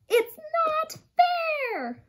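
high, slow, hammed-up sulky whine; whiny protest. Reading a picture book to children, a mock-angry whine. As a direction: stretch it out like a mock-angry kid.

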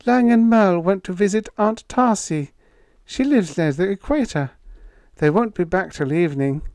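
put-on young woman voice. A narrator's reading. Vina, a young woman, speaks.

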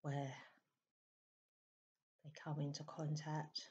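soft, unhurried meditation voice with long pauses; hushed, calm meditation guidance; guided meditation. Acted. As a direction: gently guide listeners into stillness, leaving space between phrases. Leads a meditation.

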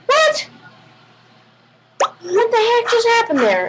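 high, baffled yelp; confused girl. Acted. Girl is pushed away, high baffled confusion. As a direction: act stunned and lost, voice up high. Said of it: "they're gonna feel like".

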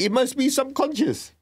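high defensive excuse; defensive protest. Retold or imitated. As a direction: push the excuse up high and urgent. Self-defence retold to teacher.

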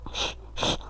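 breathy shh to calm himself; soft shushing. Shown as a demonstration. Gamer shushing himself to calm down after dying, soft shh. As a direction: hush yourself gently. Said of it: "Sakin ol".

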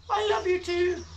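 very high little rat voice; sweet tiny rat speaking; squeaky rat voice. Acted. Rat's reply voiced.

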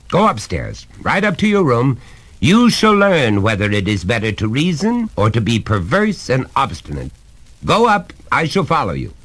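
insistent, formal scolding; stern father. Acted. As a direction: send the boy up with authority.

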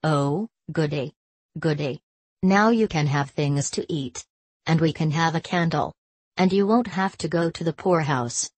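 ordinary delivery, nothing marked

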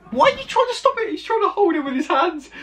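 high-pitched, fast incredulous outburst; real disbelief. Real, not acted. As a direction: voice shoots up in astonishment. Reacting in disbelief to crash clip.

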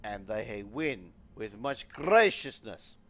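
slow, booming mock Russian proclamation; bad Soviet accent. Gamer to his viewers, in a bad Soviet accent. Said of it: "I had the worst Soviet accent ever".

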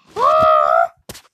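huge, loud dramatic gasp; shocked gasp. Acted. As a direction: gasp in horror.

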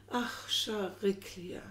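slow, drawn-out sighs on each name; sighing. Acted. Lovers in despair sigh each other's names, slow and aching. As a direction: let each name sink out on a long sigh. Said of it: "seufzten sie immer wieder".

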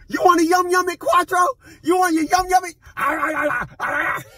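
very high squeaky pet voice; high baby talk to dog. Acted. Owner baby-talks to his dog Quattro, very high.

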